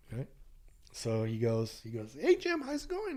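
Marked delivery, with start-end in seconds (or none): 2.2-3.1 high-pitched friendly hail; imitating a friend's high-pitched hello; retold hearty greeting. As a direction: greet an old pal, voice way up high.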